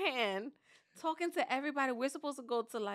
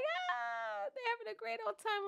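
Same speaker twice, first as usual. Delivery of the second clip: put-on much higher, fast gleeful kids; kids having fun; 12 semitones higher than usual. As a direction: squeal it, fast and high like thrilled kids. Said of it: "They're like".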